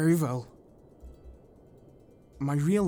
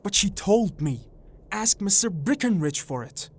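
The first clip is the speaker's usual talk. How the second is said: urgent, slightly raised little-man voice; agitated protest; 3 semitones higher, 4 dB louder, faster than usual. A narrator's reading. Little man protesting to the shopkeeper, agitated. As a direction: plead your case to the shopkeeper.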